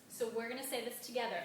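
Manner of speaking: normal talking voice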